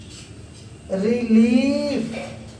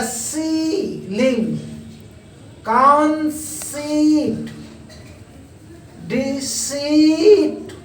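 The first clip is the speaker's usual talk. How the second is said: each example word drawn out slowly; teacher modelling the long ई sound slowly; slow word drill; 2 semitones higher, slower than usual. Shown as a demonstration. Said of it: "teacher reads example word list".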